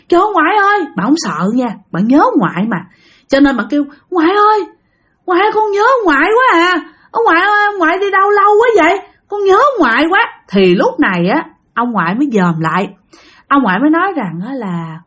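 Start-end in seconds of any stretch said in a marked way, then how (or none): 0.1-0.8 high, longing call to grandpa; acted child's cry of recognition. Granddaughter spots her late grandfather, calling out. 4.1-10.2 put-on high, plaintive child's voice; acted tearful pleading, pitched well up; longing child. Granddaughter tells late grandfather she misses him, longing.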